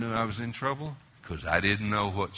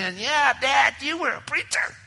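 pitched well up and louder, a hammed-up taunt; mocking kids; 7 dB louder than usual. Imagined children throwing it back at Dad, mock sneer. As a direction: sneer it like a teenager.

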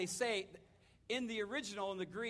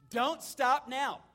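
slower, louder, higher "Don't stop now"; urgent plea; 5 semitones higher, 7 dB louder, much slower than usual. Acted. Jesus pressing a frightened father, slow loud plea. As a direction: plead it slowly, building. Jesus urging, repeated stronger.

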